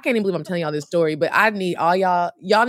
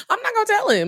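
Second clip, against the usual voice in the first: squealed vow; faster than usual. Real, not acted. Vowing secrecy, squealing.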